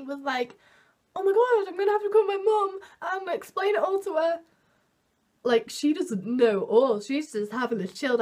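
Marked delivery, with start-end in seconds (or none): 1.1-4.4 panicked. Retold or imitated. Panicking, deciding to call mum.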